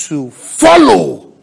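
"follow" drawn out slowly and hit loud; slow, loud stress on "follow"; emphatic preaching. Real, not acted. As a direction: stretch and punch "follow". Preacher drives home key word.

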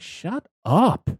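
a high, hammed-up brush-off; mock annoyed Sarah. Sarah shuts Kyle down, in a high mocking voice. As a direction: cut him off in a high mocking voice. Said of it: "And she's like".

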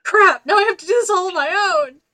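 loud hammed-up panic; mock panic. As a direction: yell it in fake dismay. Mock panic at partner quitting.

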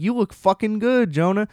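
high gushing compliment; lightly voiced starstruck fan; gushing Sharon Stone. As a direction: gush at him like an admiring fan. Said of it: "She's like".

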